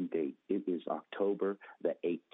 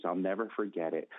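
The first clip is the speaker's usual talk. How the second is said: normal talking voice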